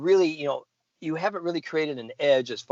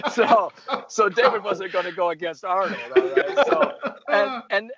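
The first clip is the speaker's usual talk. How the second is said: amused, higher-pitched laughing speech; 8 semitones higher, 5 dB louder than usual. Real, not acted. Golfer telling friends a funny story about his coach, laughing. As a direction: talk through a real laugh.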